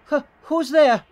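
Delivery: high, loud put-on neighbor voice; startled neighbor. Neighbor answers an unexpected knock, high startled character voice. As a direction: call out suspiciously through the door. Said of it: "I don't know why I'm giving them accents".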